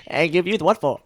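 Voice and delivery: hammed-up old-fashioned bravado; old-timey hero. Threatening a villain in the mock cartoon, laughing.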